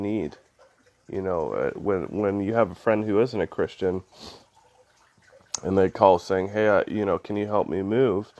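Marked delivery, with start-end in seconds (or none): none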